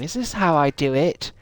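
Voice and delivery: lightly voiced confused Lara; clueless Lara voice. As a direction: play her as a clueless lady asking. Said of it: "putting the tea bag in the kettle and going".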